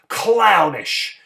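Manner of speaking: ordinary delivery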